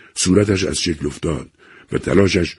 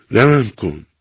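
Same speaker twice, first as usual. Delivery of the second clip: a mild raised cry for mercy; 5 semitones higher, 4 dB louder, much slower than usual. A narrator's reading. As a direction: cry out, higher and louder. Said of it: "فریاد زد".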